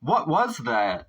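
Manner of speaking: real shock at what he heard; what the hell, said in disbelief; stunned disbelief. Real, not acted. Reacting to shocking clip.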